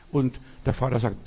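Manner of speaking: usual speaking voice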